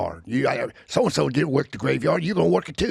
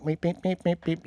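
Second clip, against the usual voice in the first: keyboard beeps; 7 semitones higher, 3 dB quieter than usual. Retold or imitated. Imitating keyboard notes.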